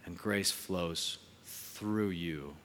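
very slow, soft blessing; closing benediction. Acted. Pastor blessing the congregation at the close, slow and soft. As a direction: bless them gently, word by word.

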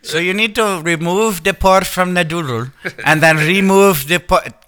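put-on slow, high Indian-accented tech support; mock Indian accent. Tech support agent giving repair steps, mock Indian accent. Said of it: "it breaks their accent".

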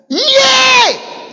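high shouted yea; emphatic shout. Acted. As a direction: burst out with it. Quoting Jesus, emphatic.

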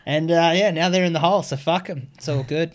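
high, laughing, gleeful. Real, not acted. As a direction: laugh through the words.

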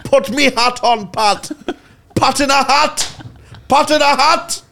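high, slow mock shout of a Trinidad special; hammed-up Pat voice. Friends mocking Pat in a hat, hammed-up shout.

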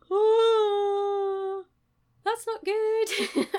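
high, loud, drawn-out mock kid voice; imitated kid, high and stretched; kid's dismay. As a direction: wail it slowly as a horrified kid. Voices the kid seeing moving stuff.

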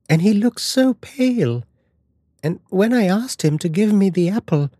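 put-on high-pitched worried child; a child anxiously telling her mother, pitched well up; frightened little girl.

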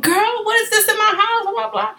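put-on frantic girl what is this; panicked. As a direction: shriek at mom about the bug. Panicked call to mom.

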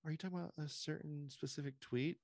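high, evasive mock voice with a rising question; mocking press secretary. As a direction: play dumb in a high put-on voice. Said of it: "and she goes".

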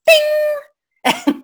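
a high, drawn-out bing; sound effect bing. Retold or imitated. Imitating the light flaring bright in the dark bedroom, high drawn-out bing. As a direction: sing the light snapping on, slow and high.

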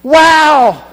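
high, loud joyous wow; amazed shout. Acted. Healed man shouts in amazement, high and loud. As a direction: burst out in wonder. It's like.

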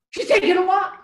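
high, loud retold excitement; excited realization. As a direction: react to surprising news, voice jumping up. Gasps at daughter's news.

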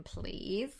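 usual speaking voice

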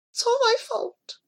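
soft, high-pitched moan; high, quiet lament. A narrator's reading. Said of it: "he moaned".

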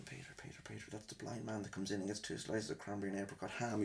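urgent whispered hiss; whispered warning. Retold or imitated. Staff whispering to Peter beside a blind customer. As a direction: warn him under your breath.

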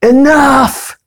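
high, loud retold scream of frustration; screaming enough. Alone in her room, screaming at herself, fed up. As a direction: shriek it out, fed up with everything. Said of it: "There were moments where I was screaming".

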